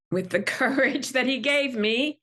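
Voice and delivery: half laughing, half crying; emotional, laughing through tears. Real, not acted. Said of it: "this really stirs up that emotion in you".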